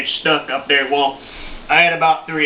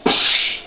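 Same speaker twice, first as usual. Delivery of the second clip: sharp unvoiced hiss of surprise; a sudden hissed psh as it lands; startled hiss; startled gasp; 4 dB quieter than usual. Kid hit by goober in stall.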